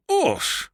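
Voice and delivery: a sharp high yelp; shocked exclamation. A narrator's reading. The uncle sees the portrait, shocked yelp. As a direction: recoil as if punched. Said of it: "he exclaimed".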